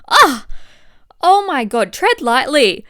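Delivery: high, loud mock alarm; sudden loud high alarm; alarmed yelp. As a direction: squeal it like bad news just landed. Reacting to gut side effects.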